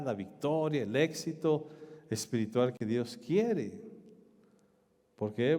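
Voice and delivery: ordinary talk, nothing marked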